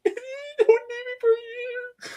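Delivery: high, slow pleading cry; hammed-up high wail, slowed down; mock child wail. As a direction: stretch it out like a sobbing kid. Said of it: "I'm like".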